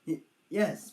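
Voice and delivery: usual speaking voice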